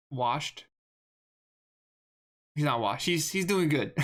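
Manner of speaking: ordinary delivery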